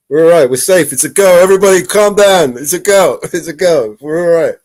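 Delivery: loud mock calming of the crowd; mock reassurance. Host loudly and jokingly telling viewers everybody calm down.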